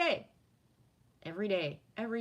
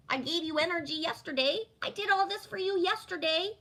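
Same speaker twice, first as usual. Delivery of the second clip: louder, higher whiny complaint; mock needy friend; 9 semitones higher, 3 dB louder than usual. Imagined crow nagging Jen for ignoring it, hammed-up whine. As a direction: ham up a clingy friend's reproach. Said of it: "No crow comes to you and says".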